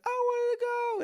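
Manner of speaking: put-on very high whiny child; little daughter's voice. Dad quoting his daughter's complaint, in a very high whiny child voice. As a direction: whine it like a disappointed toddler. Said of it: "And then she was like".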